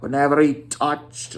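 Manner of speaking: ordinary delivery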